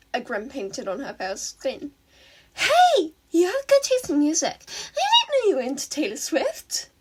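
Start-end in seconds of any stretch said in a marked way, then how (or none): 2.5-6.9 put-on high, loud, teasing girlish voice; sing-song villain greeting her rival; a villain girl teasing the hero about her music; playful villain girl. A narrator's reading.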